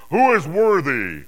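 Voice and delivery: mighty herald's call; loud proclamation. Acted. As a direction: ring out the challenge, high and loud. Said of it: "proclaiming in a loud voice".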